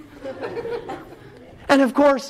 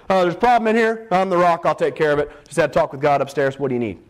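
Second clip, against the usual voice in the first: low, casual swagger; cocky Peter; 5 semitones lower, faster than usual. Acted. Peter offers to take care of it, casually boastful. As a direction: drop the voice, coolly self-important.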